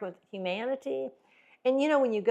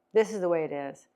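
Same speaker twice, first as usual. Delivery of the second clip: ordinary talk, nothing marked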